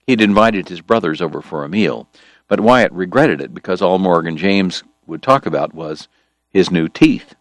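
ordinary delivery

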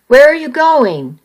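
lightly voiced shouting man. A narrator's reading. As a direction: call out to stop her. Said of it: "he shouted".

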